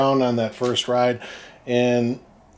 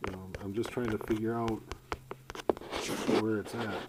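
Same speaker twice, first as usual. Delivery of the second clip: quiet; 14 dB quieter than usual. Real, not acted. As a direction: talk softly while fiddling with the wire. Quiet talk while checking footage.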